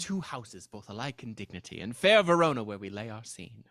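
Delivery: booming stagey Shakespearean recital; old Shakespeare accent. Pretend. Friends reciting Romeo and Juliet, old Shakespeare accent. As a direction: declaim it like an old stage actor. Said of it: "You're just doing the old accent".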